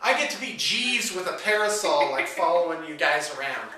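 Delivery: high, loud mock outrage; over-the-top sarcastic refusal; sarcastic indignation. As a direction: protest loudly with sarcastic delight. Mock indignation at tagging along.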